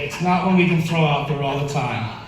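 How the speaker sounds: usual speaking voice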